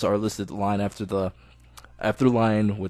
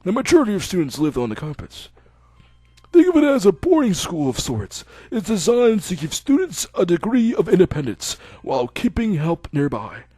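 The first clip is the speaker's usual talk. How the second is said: put-on higher, louder, measured old doctor; 6 semitones higher, 3 dB louder than usual. Old doctor telling Hisao he can go home.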